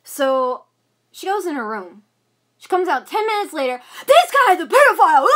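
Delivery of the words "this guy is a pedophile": loud, high mocking yell of the mother's accusation; mocking shout. Daughter mimics her mother screaming the accusation, loud mock yell. Mom's outburst retold, shouted.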